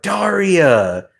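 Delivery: high, drawn-out mock call, falling away; imitated exclamation. Imitating what the listener said about Jane, exclaimed high. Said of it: "you were like".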